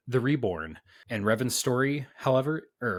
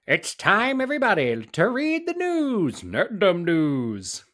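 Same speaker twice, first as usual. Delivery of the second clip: high, slow announcer voice; newscaster voice; 9 semitones higher, 5 dB louder, slower than usual. Pretend. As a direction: boom it out like an old-time news anchor. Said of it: "give us your best newscaster voice".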